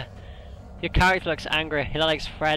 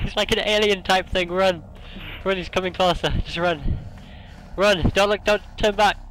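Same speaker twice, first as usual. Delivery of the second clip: higher, loud frantic calls to run; panicked urging; 4 semitones higher, 5 dB louder than usual. Real, not acted. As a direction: shout at your friend to flee. Fleeing monster, urging friend.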